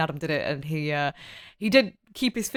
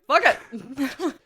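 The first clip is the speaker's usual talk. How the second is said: high, tossed-off acted quote; lightly acted careless decision; mock reckless shrug; 7 semitones higher than usual. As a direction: throw it away with comic abandon. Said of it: "we're just like".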